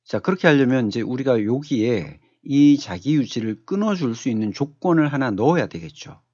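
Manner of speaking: usual speaking voice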